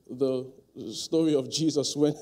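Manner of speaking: ordinary talk, nothing marked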